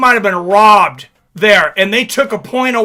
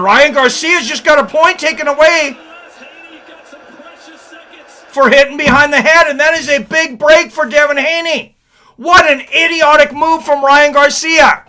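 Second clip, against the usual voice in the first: excited commentator; 5 semitones higher than usual. Real, not acted. Point deduction called live.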